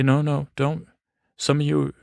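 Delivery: ordinary talk, nothing marked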